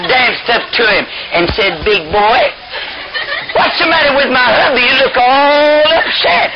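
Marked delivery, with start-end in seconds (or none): 1.8-6.5 sweet-talking queen. Acted. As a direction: coax her upset husband. Queen sweet-talks her husband.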